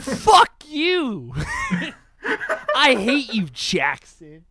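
high, loud outburst between laughs; laughing mock outrage. Real, not acted. To a teasing friend after a name joke, playful outrage. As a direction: yell back at the teasing, half laughing.

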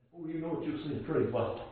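low, very soft sermon delivery; quiet, low pulpit warning with long pauses; hushed preaching. Real, not acted. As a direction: drop right down and confide it to the congregation. Preacher drops to hushed aside.

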